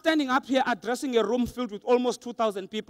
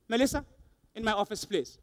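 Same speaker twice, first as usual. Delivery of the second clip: soft, lower boss voice, dropping toward the end; understated, ominous boss's summons; summoning boss; 4 semitones lower, 8 dB quieter than usual. Retold or imitated. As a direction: quietly order an employee in, with pauses. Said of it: "when the boss says to you".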